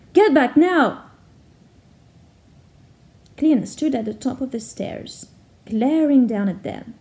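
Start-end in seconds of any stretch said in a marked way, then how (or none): 0.1-1.0 sharp loud shout, higher. Acted. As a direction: yell it to stop her. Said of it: "he shouted at her".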